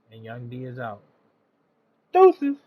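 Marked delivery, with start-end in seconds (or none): 2.1-2.6 high loud sign-off shout; excited shout. Real, not acted. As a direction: yell the goodbye.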